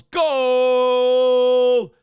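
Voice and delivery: long, high, loud held goal call; Mexican announcer voice. Acted. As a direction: bellow it like a Mexican soccer announcer, stretched out. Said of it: "in the Mexican announcer voice".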